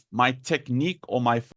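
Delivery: ordinary talk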